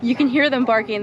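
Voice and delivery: ordinary delivery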